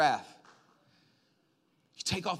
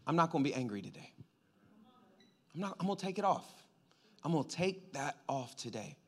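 quiet self-talk; 4 semitones lower, 10 dB quieter, faster than usual. Acted. Inner resolve voiced quietly.

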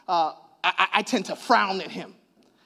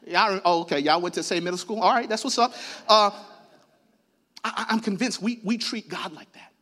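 normal talking voice

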